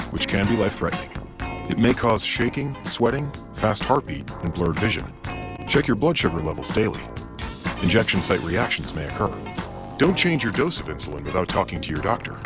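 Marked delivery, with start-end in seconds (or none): none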